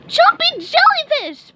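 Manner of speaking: very high, loud cartoon scream; high squeaky panicked yell. A narrator's reading. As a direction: shriek it out in alarm. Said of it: "SpongeBob screamed".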